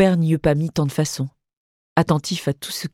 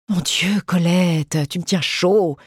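higher plaintive complaint, sick wife teasing her husband; complaining mother; 3 semitones higher, slower than usual. A narrator's reading. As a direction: whine it gently from the sickbed. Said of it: "se plaignait-elle".

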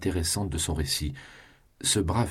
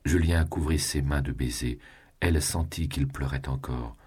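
ordinary delivery, nothing marked

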